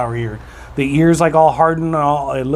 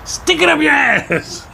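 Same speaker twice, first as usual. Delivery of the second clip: mock shout; 12 semitones higher, slower than usual. Punchline of pharmacy joke.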